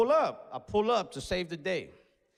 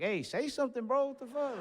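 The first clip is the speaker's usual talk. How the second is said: high mock plea to boyfriend; 9 semitones higher than usual. Imagined prompting the boyfriend.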